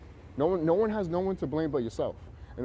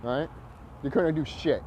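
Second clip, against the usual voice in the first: ordinary talk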